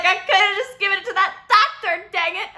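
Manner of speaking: high, loud, giggly mock regret; laughing joke. Joking about sending the meme to the doctor, laughing. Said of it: "And I was like".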